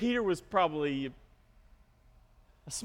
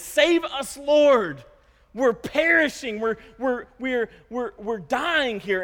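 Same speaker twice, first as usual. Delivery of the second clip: raised, urgent cry for rescue; panicked plea; 4 semitones higher, 4 dB louder, slower than usual. Acted. Disciples running to Jesus in the storm, panicked plea. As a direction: cry out as if drowning. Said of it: "they run up to Jesus, and what do they say to him".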